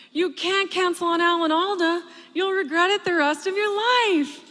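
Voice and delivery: stepdad's alarmed yell, pitched high; dramatic loud yell. Retold or imitated. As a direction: yell it like an overdramatic dad on the phone. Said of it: "he yelled loudly through the phone".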